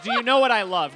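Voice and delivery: laughing correction. Shown as a demonstration. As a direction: blurt "Do you know what I love?" through laughter.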